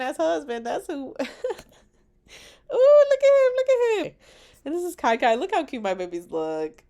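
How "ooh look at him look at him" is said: high, loud squeal of delight; gushing. Real, not acted. Bride gushing over a photo of her husband, squealing.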